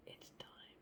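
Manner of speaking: hushed, voiceless it's time; whisper. Acted. Reader whispers to the client that it's time. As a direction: breathe the words like a secret.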